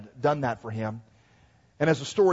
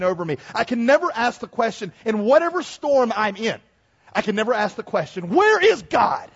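high, loud preaching building to a shout; forceful preaching; 6 semitones higher, 5 dB louder than usual. Real, not acted. Preacher declares he never questions God's love, forceful preaching. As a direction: drive it upward to a ringing cry.

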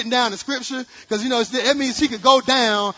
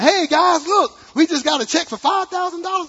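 high, loud mock excitement to the kids; father calling his kids over, playfully excited; 9 semitones higher, 6 dB louder than usual. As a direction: hype the kids up, teasing.

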